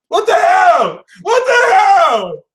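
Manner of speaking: very high, loud, drawn-out mock wail; shrieking what the hell. Reader wailing at the Capricorn card, high shriek. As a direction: wail it like it's the last straw.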